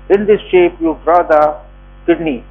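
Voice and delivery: ordinary delivery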